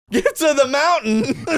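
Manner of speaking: high, loud, slow title read-out; drawn-out, hammed-up announcement; mock-grand misreading. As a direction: belt out the wrong title for laughs. Reads out the misspelt card title.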